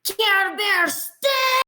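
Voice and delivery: high-pitched, drawn-out battle cry; battle-cry shout. Acted. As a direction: shout it out as a character's attack call. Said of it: "shouts out very loudly".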